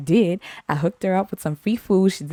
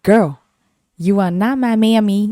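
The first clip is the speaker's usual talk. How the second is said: sassy comeback; 3 semitones higher, 4 dB louder than usual. Retold or imitated. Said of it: "I said".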